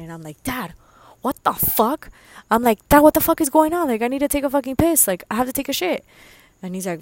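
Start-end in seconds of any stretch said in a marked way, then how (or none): 0.4-6.0 loud, high, rushed panic to dad; panicking. Retold or imitated. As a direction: re-enact a frantic phone call. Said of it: "I'm panicking".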